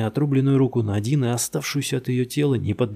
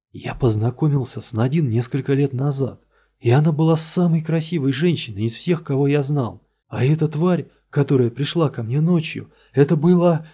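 higher and louder, building; mounting agitation; 5 semitones higher, 5 dB louder than usual. Acted. Suspect telling the detective about Nadine, rising agitation. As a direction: let it climb to breaking.

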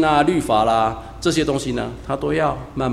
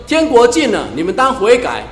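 大声宣告; 7 semitones higher, 6 dB louder than usual. Retold or imitated. Quoting Jesus' call to repent.

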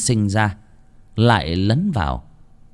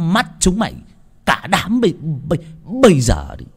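furious yell; 6 semitones higher than usual. A narrator's reading. Servant Sửu screaming at the laborers to greet the master. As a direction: rage and stutter at the laborers. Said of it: "Thằng Sửu quát".

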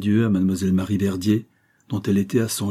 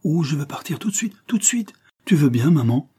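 put-on high-pitched little boy, climbing higher on the repeat; excited child; 6 semitones higher than usual. A narrator's reading. Child begging mother to leave at once, excited high voice. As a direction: plead eagerly like an impatient child. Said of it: "avec l'adorable versatilité de son âge".

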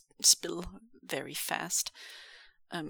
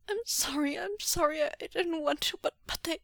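high-pitched, tearful stammer; panicky, halting confession; trembling apology; 11 semitones higher than usual. Acted. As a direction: plead for forgiveness, falling apart. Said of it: "Xuanyu's lips trembled".

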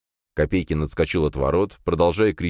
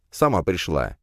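ordinary delivery

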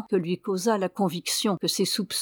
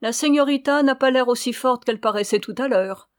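raised, louder taunting tone; mocking; 5 semitones higher, 5 dB louder than usual. A narrator's reading. Signora taunting faint Ramona, mocking. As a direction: needle them with mock concern. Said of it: "elle disait d'un ton moqueur".

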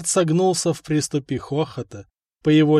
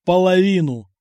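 louder, clipped final word; flat firm verdict; 5 semitones higher, 6 dB louder than usual. Acted. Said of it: "припечатал владыка".

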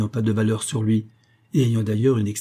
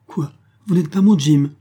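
surprised; 5 semitones higher, 6 dB louder than usual. A narrator's reading. Old woman startled by denial.

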